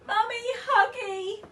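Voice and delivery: put-on high, loud toddler scream; screaming baby. A narrator's reading. Baby wanting mum, screaming. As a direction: shriek it like a furious toddler. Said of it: "screamed the baby".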